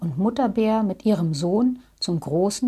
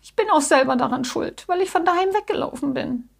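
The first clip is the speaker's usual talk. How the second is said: high, tearful little-bear wail; gasp, then let it pour out high and tearful; acted sobbing; 8 semitones higher, faster than usual. A narrator's reading. Bear blaming himself for running away, acted sobbing. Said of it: "schluchzte Jackie".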